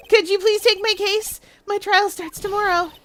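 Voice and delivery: high, urgent plea; pleading client. Acted. Client asks lawyer to take the case, pleading.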